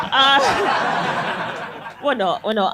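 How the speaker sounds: normal talking voice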